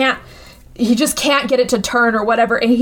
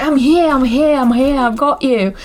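lightly Australian, urgent reassurance; Australian accent. Retold or imitated. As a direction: let the Australian vowels slip in. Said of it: "her Australian accent starts slipping through".

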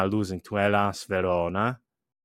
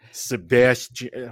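exaggerated American accent, slow and drawn out; 3 semitones higher, 4 dB louder, slower than usual. Shown as a demonstration. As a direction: put on your most American accent, badly. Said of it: "put on my most American accent".

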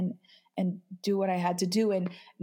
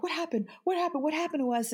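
higher, panicked repeated question; imitating panic; 7 semitones higher, much faster than usual. Grieving mother to the host, reliving her panic, high and frantic. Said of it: "I'm like".

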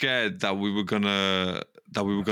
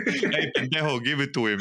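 higher, laughing mock Spanish accent; 4 semitones higher than usual. Podcaster topping his co-host's footballer impression, laughing Spanish accent. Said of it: "in the accent".

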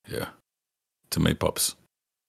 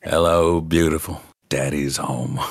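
low impression of a famous actor, dropping lower at the end; Jack Nicholson; 5 semitones lower than usual. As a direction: let the star's voice fully out, pitched down. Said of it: "Jack Nicholson's coming out".

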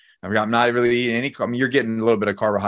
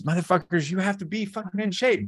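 high-pitched, fired-up insistence; impassioned exhortation; 8 semitones higher, 5 dB quieter than usual. Real, not acted. Coach urging men to get in shape, fired-up exhortation. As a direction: lay down the rule with heat, pitch climbing.

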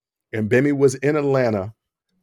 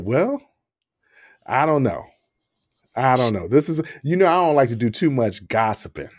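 normal talking voice

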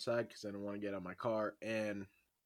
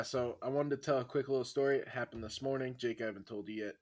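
ordinary talk, nothing marked

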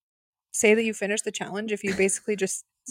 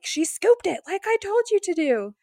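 high-pitched imitated yell; hammed-up exasperated telling-off; mock scolding; 11 semitones higher than usual. Jonathan scolding Victoria over the soup, mock yelling.

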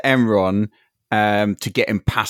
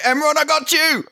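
high-pitched heroic call-out; in-character shout. Acted. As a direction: shout to a friend in danger. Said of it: "I would be shouting".

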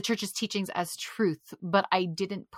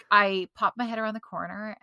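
normal talking voice

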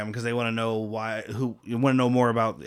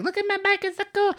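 very high squeaky child impression; mock little-girl squeal; faster than usual. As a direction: pitch it way up like a little kid. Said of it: "he's talking to Nicole, and she's like".

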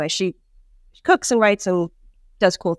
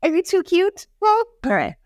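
high cooing pet talk, much higher; 9 semitones higher than usual. Real, not acted. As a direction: coo at a cute dog in a high voice. Talking to her pet Coco.

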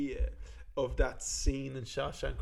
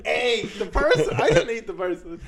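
loud, much higher, laughing plea; mock earnest plea; 8 semitones higher, 10 dB louder than usual. Prisoner swears a clown did it, loud and laughing. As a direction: insist earnestly while cracking up.

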